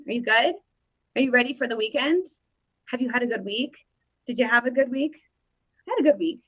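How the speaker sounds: ordinary talk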